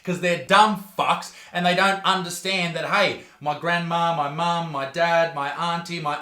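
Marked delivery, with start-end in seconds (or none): none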